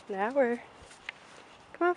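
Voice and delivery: ordinary talk, nothing marked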